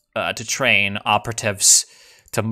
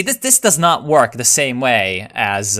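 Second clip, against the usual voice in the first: ordinary delivery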